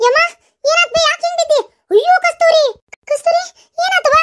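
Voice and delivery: high, loud, startled calling out; shocked woman calling to the fallen girl; alarmed cry. Acted. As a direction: rush over in panic. Finds pregnant girl fallen.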